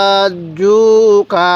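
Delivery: "হাজ্জুকা" very slowly, doubled জ held; slow model reading. Shown as a demonstration. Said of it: "এরকমভাবে শিখব।".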